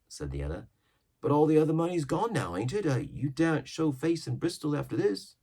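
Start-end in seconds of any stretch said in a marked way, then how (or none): 1.2-5.4 put-on higher young lad voice; young sailor. Young seaman answering Silver, higher lad's voice. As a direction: sound green and doubtful.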